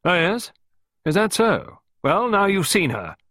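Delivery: high, loud, hearty dismissal; see off a visitor in a robust high voice; brisk lady gardener. A narrator's reading. Miss Peake seeing off an unwelcome visitor, robust.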